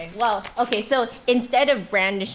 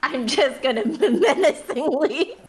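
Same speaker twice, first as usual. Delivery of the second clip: higher, slow words broken by laughter; real giggling; 5 semitones higher, 3 dB louder than usual. Real, not acted. Player describes menacing with a crossbow, giggling through it. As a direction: try to get it out while laughing.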